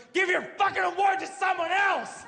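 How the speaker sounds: high furious yell at her; angry shout. Retold or imitated. Director storming out, shouting back at the organizer. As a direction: storm out yelling it over your shoulder. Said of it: "He looks back at her and goes".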